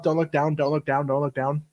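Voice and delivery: quiet, low, repeated self-reminder; self-coaching under breath. Acted. Asking Erica how actors talk to themselves, hushed self-talk. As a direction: tell yourself softly and insistently. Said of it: "do you have to tell yourself".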